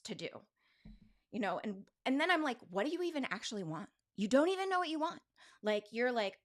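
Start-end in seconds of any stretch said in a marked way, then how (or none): 2.7-5.2 fast, rising to a much higher, louder jab; impatient inner critic. Retold or imitated. Inner voice demanding what she wants, fast and sharp. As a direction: rattle it off and snap at yourself.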